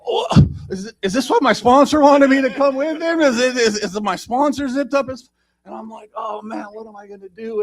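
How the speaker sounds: high, flustered mock panic. Narrator replaying his panic at the urinal. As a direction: play the rising alarm for laughs. Panicked inner reaction retold.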